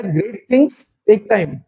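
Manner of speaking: very slow, spaced-out words; teacher repeating the proverb. Shown as a demonstration. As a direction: repeat it slowly for them to copy. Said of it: "एक word होता है".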